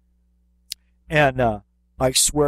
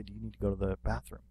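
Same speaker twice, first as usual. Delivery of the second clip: much softer, quick, leaning in close; quiet private question; 4 semitones lower, 12 dB quieter, faster than usual. Retold or imitated. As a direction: ask it softly so nobody else hears. Leaning in to ask a kid privately.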